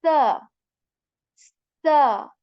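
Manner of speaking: "sa" loud with a sharp whistling S. Shown as a demonstration. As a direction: let "sa" whistle like English S. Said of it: "There is this whistle in it".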